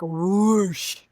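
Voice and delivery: loud, drawn-out whoosh noise; imitated whoosh. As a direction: make a rushing whoosh for the feeling welling up. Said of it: "feel it as, like".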